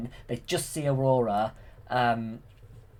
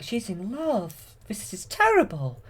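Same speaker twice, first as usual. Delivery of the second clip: much higher worried fairy; 10 semitones higher than usual. Retold or imitated. Fairies reacting to Aurora's love, in a higher worried voice. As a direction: pitch it up like a fretting fairy. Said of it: "the fairies are like".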